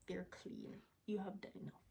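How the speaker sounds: hushed, soft reassurance; comforting a tired mom in a whisper; tender whisper. Real, not acted. Reassuring struggling moms.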